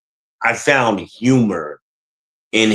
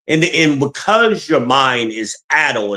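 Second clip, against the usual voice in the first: usual speaking voice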